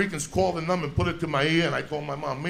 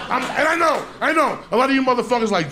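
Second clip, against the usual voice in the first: ordinary talk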